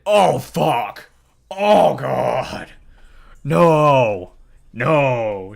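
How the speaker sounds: loud cries of pain; anguished shouting. Acted. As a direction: cry out against the torture. Torture continues.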